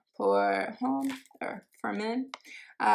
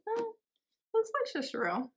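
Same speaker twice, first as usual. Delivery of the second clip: normal talking voice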